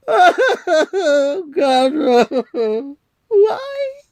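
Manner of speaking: high, sobbing wail; comic sobbing breakdown; crying. Acted. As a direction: break down in tears over it. Santa breaks down over childhood.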